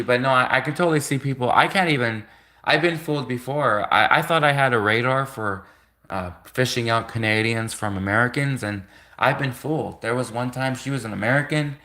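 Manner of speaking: usual speaking voice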